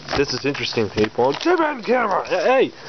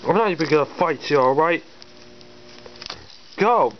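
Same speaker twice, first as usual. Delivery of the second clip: usual speaking voice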